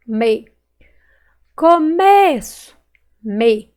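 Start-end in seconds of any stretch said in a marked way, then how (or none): 1.6-2.8 "Começo" slow and loud, high long ME; stretched stressed syllable. Shown as a demonstration. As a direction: call out "Começo", holding ME. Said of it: "Qual que eu demorei mais falar".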